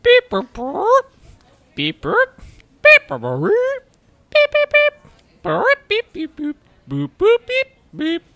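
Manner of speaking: playful robot chirps; robot beeps. Pretend. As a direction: beep it out like a little droid. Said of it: "I don't speak Druid".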